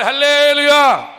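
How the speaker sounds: a very loud, slow, drawn-out hallelujah; thundering hallelujah. Real, not acted. Preacher leading the church to roar back, huge drawn-out shout. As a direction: make the walls shake. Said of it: "దద్దరిల్లిపోయేలా చెప్పాలి".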